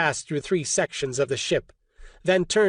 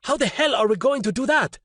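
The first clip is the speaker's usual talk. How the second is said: higher, pained outburst; horrified outburst; 7 semitones higher than usual. A narrator's reading. Roberto despairing over injured crewmate, horrified outburst. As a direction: blurt it out in horror. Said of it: "Roberto looked horrified".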